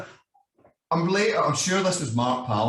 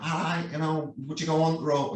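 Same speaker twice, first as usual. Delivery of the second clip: higher-pitched put-on Yorkshire accent; 6 semitones higher than usual. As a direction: imitate a caller in a Yorkshire accent. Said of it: "that's my Yorkshire accent".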